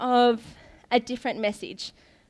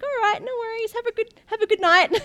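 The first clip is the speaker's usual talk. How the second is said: mock polite farewell; 11 semitones higher than usual.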